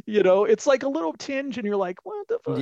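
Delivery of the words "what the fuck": high-pitched baffled reaction; hushed-volume-free squeal of mock confusion; mock disbelief. Mock reaction to a sudden accent.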